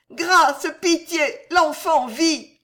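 high, loud, drawn-out plea; anguished high entreaty; begging for mercy. A narrator's reading. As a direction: cry out desperately over her accuser. Alice cries for mercy.

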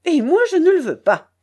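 a loud, high, forceful no; energetic refusal. Acted. Charcot forbids the narrator to sing, loud and forceful. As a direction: slam the door on his request. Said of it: "déclara-t-il énergiquement".

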